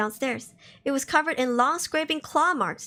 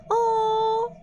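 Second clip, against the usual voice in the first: creepy let-me-in voice; 6 semitones higher, much slower than usual. Pretend. Mimicking the creepy voice.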